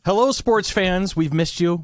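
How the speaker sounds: slow high sports host hello; announcer greeting. Acted. Show host opener.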